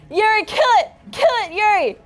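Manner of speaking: high, loud urgent command; frantic yelling. Acted. Player yelling at Yuri to kill the monster, high and loud. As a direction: scream at your friend to act. Player urges another mid-fight.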